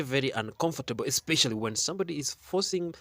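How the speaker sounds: ordinary talk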